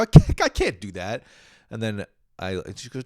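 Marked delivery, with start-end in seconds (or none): 0.0-1.2 loud whining refusal; whiny protest. Retold or imitated. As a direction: whine it out loud. Quoted protest.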